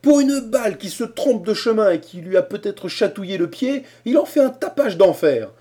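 high, loud scornful rant; mock the fuss in anger; exasperated complaint. A narrator's reading. Harrison complains of the old man's uproar.

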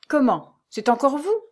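loud, high, affronted question; indignant surprise. A narrator's reading. Concierge blocking Lucie's way on her return, indignant surprise. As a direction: challenge the intruder, pitch climbing. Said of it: "dit-il en lui barrant le passage".

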